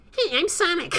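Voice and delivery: very high, squeaky chipmunk voice; chipmunk Sonic. Retold or imitated. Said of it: "You might think".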